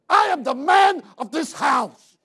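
slow, loud, high husband's shout; domineering yell. Acted. Husband asserting himself to his wife, slow furious shout. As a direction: thunder it slowly, laying down the law. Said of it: "he was yelling at his wife".

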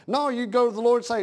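ordinary delivery

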